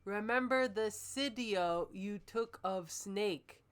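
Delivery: ordinary talk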